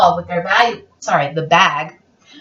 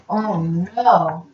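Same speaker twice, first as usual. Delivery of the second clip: ordinary delivery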